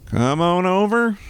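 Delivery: high, slow, drawn-out call; loud-ish sing-song beckoning; playful calling out. Pretend. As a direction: stretch it out in a rising silly voice.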